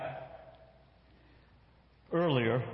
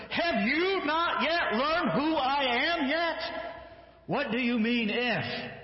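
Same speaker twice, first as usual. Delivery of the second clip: exasperated challenge; 11 semitones higher, slower than usual. Acted. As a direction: demand it in disbelief. Jesus challenging the father.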